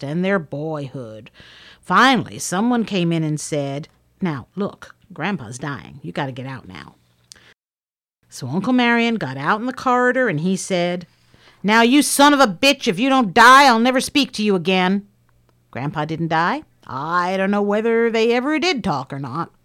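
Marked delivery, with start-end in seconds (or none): 4.2-7.0 soft, falling away at the end; hushed urging. Retold or imitated. Someone tells Uncle Marion Grandpa's dying, hushed urging. 11.6-15.1 loud, higher-pitched retold threat; angry outburst. Uncle Marion to dying Grandpa from the corridor, angry outburst.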